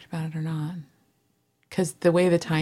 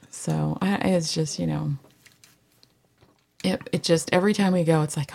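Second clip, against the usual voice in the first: ordinary talk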